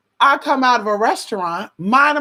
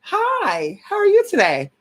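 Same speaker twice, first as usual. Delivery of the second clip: pitched-up startled greeting; surprised high hello; 12 semitones higher, slower than usual. Retold or imitated. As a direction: caught off guard, chirp it high. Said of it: "you're gonna be looking like".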